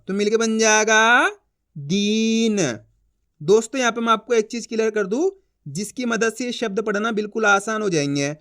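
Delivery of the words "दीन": दीन with a held ī and light final n; long drawn-out vowel. Shown as a demonstration. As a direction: stretch दीन into "deeen". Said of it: "खींच खींच के उच्चारण करना है".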